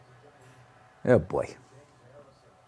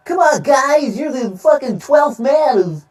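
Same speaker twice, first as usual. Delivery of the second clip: high, slow fawning owner voice; butter up the fans like a smarmy owner; sucking-up team owner; 10 semitones higher, slower than usual. Retold or imitated. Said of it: "sucking the dick of Seattle fans".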